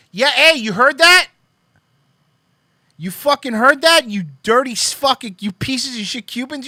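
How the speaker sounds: high, very loud caricatured tirade; mock rant. Parodying the tweeter yelling at Cubans, a loud mock tirade. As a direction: scream it like an unhinged troll.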